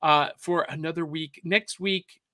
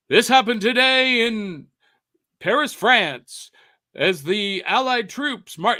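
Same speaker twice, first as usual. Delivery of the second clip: put-on old newsreel announcer; 5 semitones higher, 6 dB louder than usual. Host imitating a newsreel voice for his co-host. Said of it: "you've seen those old newsreels".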